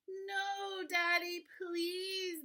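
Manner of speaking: high, loud, drawn-out plea; begging children. A narrator's reading. Children begging Daddy to spare their dog, high pleading. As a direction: wail a storybook child's "please". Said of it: "begged Betty and Billy".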